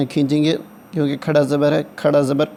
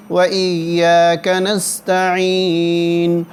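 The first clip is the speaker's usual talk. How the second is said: slightly raised, steady chanting of the verses; Quranic recitation; 5 semitones higher, much slower than usual. Shown as a demonstration. Teacher reciting Fatiha line by line for students, chanting. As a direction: recite each phrase slowly and repeat it.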